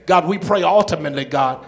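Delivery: loud preacher's closing prayer, building; full-force preaching. Real, not acted. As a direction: lead the church in praise at full voice. Closing prayer and praise call.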